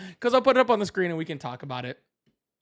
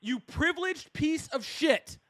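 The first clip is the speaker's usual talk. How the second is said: higher, heated insult; angry insult; 5 semitones higher, 4 dB louder than usual. Real, not acted. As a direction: spit the insult at him. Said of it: "you're getting mad".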